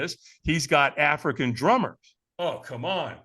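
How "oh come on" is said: ordinary delivery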